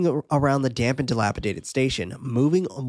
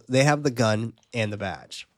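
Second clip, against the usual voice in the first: normal talking voice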